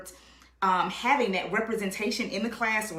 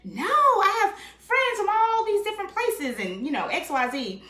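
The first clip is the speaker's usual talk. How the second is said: incredulous retort; 14 semitones higher, 5 dB louder than usual. Retold or imitated. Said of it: "And I was like".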